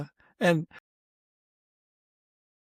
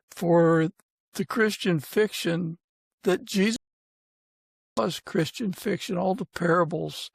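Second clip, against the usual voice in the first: earnest, unhurried prayer voice; gentle closing prayer, reverent and steady. Real, not acted. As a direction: pray sincerely over the guest and his family.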